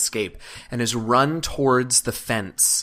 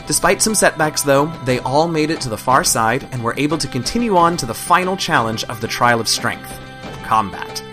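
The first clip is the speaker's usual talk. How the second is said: narration; 2 semitones higher, 5 dB louder than usual. A narrator's reading. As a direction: lively recap narration. Game master narration recap.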